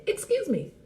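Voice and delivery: high, polite interjection; a courteous, high-pitched pardon. Shown as a demonstration. Offering an alternative to sorry, polite. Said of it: "I wanna do it politely".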